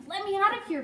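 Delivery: high-pitched little plea; pleading. A narrator's reading. Caged mouse begs the boy, pleading. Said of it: "pleaded Ralph".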